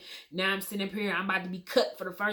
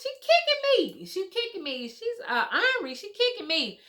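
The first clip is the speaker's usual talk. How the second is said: higher, lively exclaiming; mock excited doctor; 12 semitones higher, 3 dB louder than usual. Doctor pushing on her belly feels the baby kick, excited. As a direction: cry out that she's kicking. Quoting doctor feeling the kicks.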